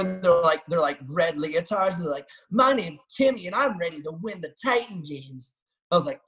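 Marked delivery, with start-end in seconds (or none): 2.5-5.5 high, mocking eager-beaver voice; hammed-up naive rookie, pitched up; mock eager amateur. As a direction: send up a keen amateur contestant. Mocking amateur athletes in leotards.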